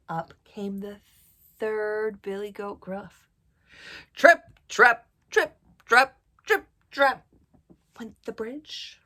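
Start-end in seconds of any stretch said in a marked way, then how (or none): 4.1-7.2 loud, heavy trip-trap stamps; louder hoofbeats. A narrator's reading. Storyteller sounding the heaviest goat's steps, loud stamping. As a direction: pound out each step loudly. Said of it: "so it got louder".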